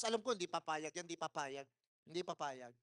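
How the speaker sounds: fading from soft to near-silent murmur; quiet inner doubt. Retold or imitated. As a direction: say it to yourself, dying away. Inner doubt while waiting.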